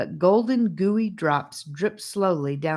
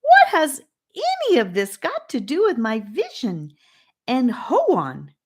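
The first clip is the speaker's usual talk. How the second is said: much higher and louder, indignant; soaring high voice; 9 semitones higher, 6 dB louder than usual. A narrator's reading. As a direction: pitch the whole question way up. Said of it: "raising his voice to a soaring height".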